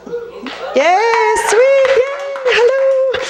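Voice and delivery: high excited yay; cheering the East Coast crowd; mock cheer. Real, not acted. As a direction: cheer back brightly. Cheering back at audience.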